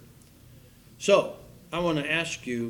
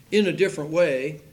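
ordinary talk, nothing marked

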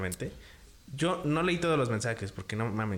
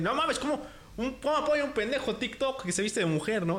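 much higher, mock outraged hater; hater indignado; 9 semitones higher than usual. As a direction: sneer like an online troll. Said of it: "le van a echar hate". (hater burlón e indignado, voz más aguda)

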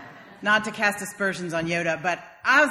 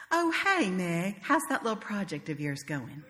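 sugar-coated condescension; sweetly discouraging; 2 semitones higher, 6 dB quieter than usual. Retold or imitated. Southern family member belittling Meg's little project, sweetly. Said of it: "discourage one another sweetly".

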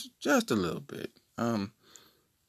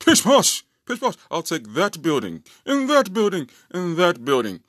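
loud, high-pitched mock toff; posh tycoon; 6 semitones higher, 5 dB louder than usual. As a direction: haughtily snap up buildings like a hammed-up aristocrat. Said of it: "said it with, like, such posh".

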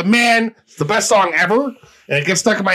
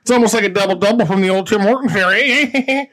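put-on Canadian lilt, rising on eh; hammed-up Canadian; Canadian accent. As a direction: play up a folksy Canadian accent. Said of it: "Was that too Canadian?".

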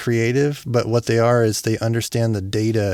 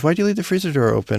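imitating wife; 7 semitones higher, faster than usual. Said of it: "and she goes".

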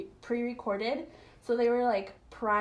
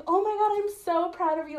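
excited friend; 7 semitones higher, 4 dB louder, faster than usual. Retold or imitated. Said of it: "And she's like".

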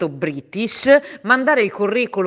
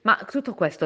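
usual speaking voice

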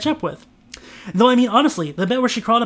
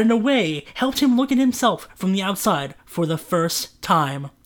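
ordinary delivery

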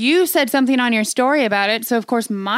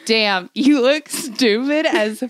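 slow disgusted; much slower than usual. Retold or imitated. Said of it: "I rewatched it and I was like".

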